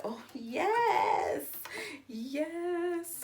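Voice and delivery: delighted high exclaim; excited. Real, not acted. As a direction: squeal with delight. Delight at crunch.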